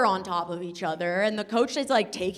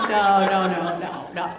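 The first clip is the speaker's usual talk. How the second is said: normal talking voice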